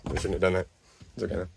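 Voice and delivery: very low, soft aside; drained, hushed and low; subdued regret. Real, not acted. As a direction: say it quietly to yourself after an outburst. Regret after the outburst.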